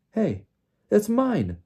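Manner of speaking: high exclamation, rising in volume; startled high outburst; Harry surprised. A narrator's reading. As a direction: blurt it out on recognition. Harry suddenly recognizes his wand.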